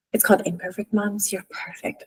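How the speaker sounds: kids' words passed along in a soft unvoiced whisper; whispered kids' quote. Mom retelling what her kids told her, whispered. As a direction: hush it like a secret from the kids.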